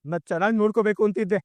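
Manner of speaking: normal talking voice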